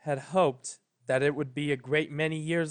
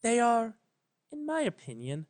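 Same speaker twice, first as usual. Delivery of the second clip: careful Celestia; 7 semitones higher than usual. A narrator's reading. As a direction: pick each word with care, gently. Celestia voiced.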